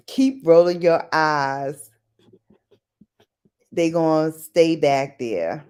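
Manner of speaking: low, drawn-out warning; slow warning. Retold or imitated. Recalling an old saying for viewers, slow low warning. Recites a remembered parental warning.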